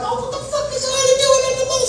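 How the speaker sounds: shocked outburst. Retold or imitated. Coworker spotting him on Cab TV, high shocked yelp. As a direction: yelp in disbelief.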